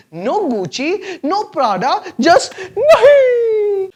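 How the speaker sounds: slow, high, laughing cry; hammed-up sob over no shopping; mock wail.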